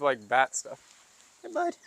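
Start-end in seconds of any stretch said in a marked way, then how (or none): 1.4-1.8 very high call to the cat. Real, not acted. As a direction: sing it up high to lure him. Calling the cat.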